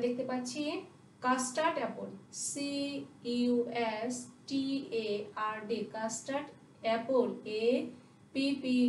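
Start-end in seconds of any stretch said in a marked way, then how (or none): none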